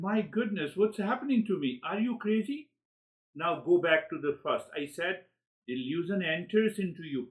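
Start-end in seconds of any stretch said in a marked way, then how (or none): 0.0-2.7 amused self-surprise. Retold or imitated.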